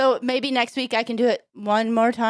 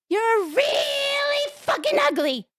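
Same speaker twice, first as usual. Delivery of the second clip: very high, slow parrot screech; talking parrot; slower than usual. Acted. Parrot insults a passing lady, high parrot squawk. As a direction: squawk it high and drag the vowels out. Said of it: "Parrot says".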